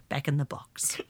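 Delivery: whispered hush to herself. Pretend. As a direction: whisper it, tucking the rant away. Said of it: "Now, shh".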